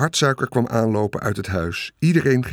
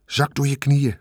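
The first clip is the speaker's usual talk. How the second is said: usual speaking voice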